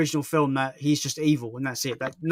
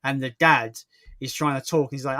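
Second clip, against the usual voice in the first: ordinary talk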